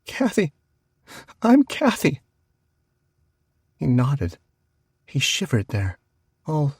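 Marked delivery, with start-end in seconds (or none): 0.0-2.2 high, squeaky frightened reply; squeak. Acted. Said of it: "and I squeaked".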